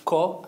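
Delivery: "Ko" echoed with a hard k. Shown as a demonstration. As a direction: make a hard stop to start "Ko". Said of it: "three make harder sounds".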